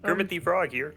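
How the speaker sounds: Kermit the Frog voice. Retold or imitated. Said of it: "Do your best Kermit the Frog impression".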